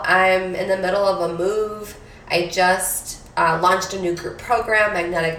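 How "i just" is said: normal talking voice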